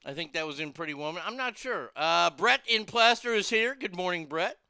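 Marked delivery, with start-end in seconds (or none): none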